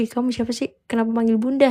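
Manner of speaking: ordinary talk, nothing marked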